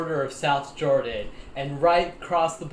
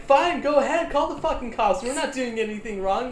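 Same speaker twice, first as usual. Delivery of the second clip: defiant dare; 9 semitones higher, 3 dB louder than usual. Retold or imitated. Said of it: "And he's like".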